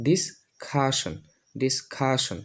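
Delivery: ডিসকাশন slowly split, "dis-KHA-shan", with aspirated k; new pronunciation. Shown as a demonstration. Teacher models for learners, ডিসকাশন as slow "dis-khashan". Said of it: "কটাকে উচ্চারণ করব খ".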